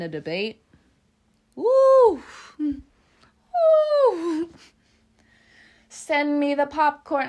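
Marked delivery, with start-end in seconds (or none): 1.5-4.5 high, loud, drawn-out "woo"; excited whoop. Real, not acted. Tarot reader relishing a debate, excited whoop. 5.9-7.3 high, loud, eager mock order; playful call. Tarot reader ready to watch a debate, playful high call.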